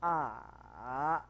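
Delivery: "A" dipping low, then rising; third tone. Shown as a demonstration. Teacher demonstrating the third tone for a student. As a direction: dip "A" and bring it back up. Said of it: "Coba nada ketiga".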